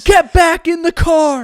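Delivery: high, drawn-out shout; screaming officer. Retold or imitated. Officer ordering a teen driver back into his car, screaming. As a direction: bellow a slow, urgent order. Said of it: "screams at the top of his lungs".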